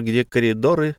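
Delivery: ordinary delivery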